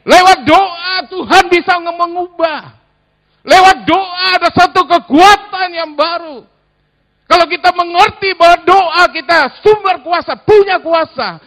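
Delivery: high, loud preacher's cry on prayer's power; soaring sermon climax; full-force preaching. Acted. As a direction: preach it out at the top of the voice.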